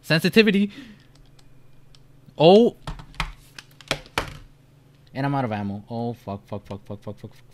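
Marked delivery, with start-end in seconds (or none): 6.1-7.4 muttered curses. Real, not acted. Out of ammo.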